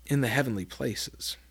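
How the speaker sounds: ordinary delivery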